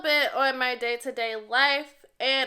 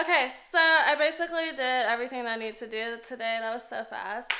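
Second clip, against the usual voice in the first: ordinary talk, nothing marked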